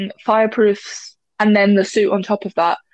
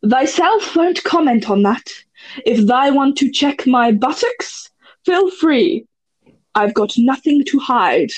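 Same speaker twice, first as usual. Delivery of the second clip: mock-grand Shakespearean stage reading; theatrical Shakespearean; 3 semitones higher, slower than usual. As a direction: declaim it like an overblown Bard. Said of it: "my most theatrical sort of accent".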